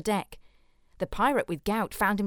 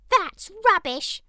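much higher, louder, slow pirate; 12 semitones higher, 7 dB louder, slower than usual. A narrator's reading. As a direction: squawk it like a shrill buccaneer. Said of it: "said the albino pirate".

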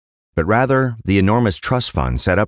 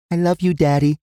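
put-on high-pitched little girl voice; small child's loving goodnight, pitched up; young daughter, affectionate; 11 semitones higher than usual. Daughter telling dad she loves him, high child voice.

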